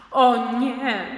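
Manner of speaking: loud exclamation of no; boy objecting to his father, loudly; protesting shout. Acted. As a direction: cry out in protest. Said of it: "krzyknąłem".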